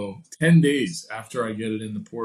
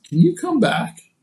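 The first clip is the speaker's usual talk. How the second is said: much higher, slow, louder mock tech voice; tech asking back; 13 semitones higher, 8 dB louder than usual. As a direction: stretch it up high like a nervous technician. Imitating tech calling on the phone.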